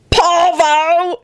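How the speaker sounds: high, comic holler; shouted punchline. A narrator's reading. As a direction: cry out the name like a catchphrase. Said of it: "he shouted out".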